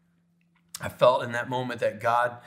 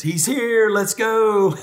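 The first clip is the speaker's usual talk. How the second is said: high, loud gleeful holler; hammed-up joyful shout; excited dad imitation; 10 semitones higher, 7 dB louder, much slower than usual. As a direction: burst out as dad, thrilled to be going. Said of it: "he's excited".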